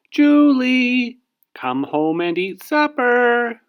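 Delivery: high, loud call down the street; calling from afar. Acted. Mother calling Julie home from down the street, loud and high. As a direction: call her home from far away. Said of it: "someone called".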